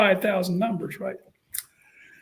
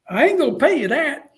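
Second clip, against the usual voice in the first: higher-pitched blunt refusal; higher coach voice; Spurrier voice; 8 semitones higher, 4 dB louder than usual. Retold or imitated. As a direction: turn it down flatly, pitched up high. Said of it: "he goes".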